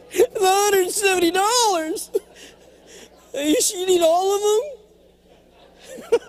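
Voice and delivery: high, slow laughing cry of dismay; incredulous laughing whine; laughing disbelief. Real, not acted. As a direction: laugh through the pain of the bill. Reacting to transcript costs.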